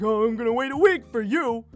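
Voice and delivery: high, snide mock-sweet taunt; petty sarcastic revenge line; sarcastic payback. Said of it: "I'm gonna be like".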